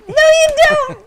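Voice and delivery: loud teasing denial. Pretend.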